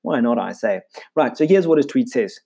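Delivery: ordinary delivery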